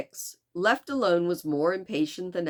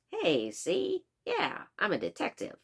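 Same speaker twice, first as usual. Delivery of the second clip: hammed-up Chicago detective accent, starting high; Mister Fix; 4 semitones higher than usual. Shown as a demonstration. Reader jokingly demonstrates the detective, poor Chicago accent. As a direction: do a cartoonish, badly faked Chicago accent. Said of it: "my very poor attempt at a Chicago accent".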